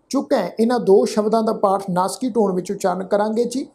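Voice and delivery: usual speaking voice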